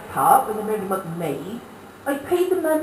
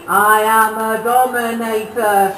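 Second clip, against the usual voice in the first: put-on toothless old wife; 7 dB louder, slower than usual. Old wife announcing herself to her husband, slow and loud. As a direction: declare it slowly like a toothless old dame. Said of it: "She put her teeth back in and said".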